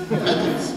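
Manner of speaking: Medals punched out loud and breathy; emphatic repeat. A narrator's reading. Gran boasting about her prizes, Medals punched loud. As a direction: hit Medals hard, proud.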